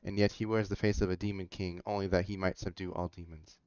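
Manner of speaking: ordinary delivery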